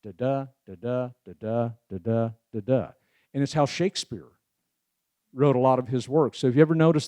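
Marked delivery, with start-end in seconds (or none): 0.0-2.9 da-da da-da in an even iambic rhythm, lighter then stressed; ten count beat. Shown as a demonstration. Speaker demonstrating iambic pentameter, chanting da-DA. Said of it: "which is a ten count".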